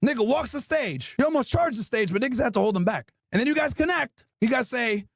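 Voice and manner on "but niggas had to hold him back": usual speaking voice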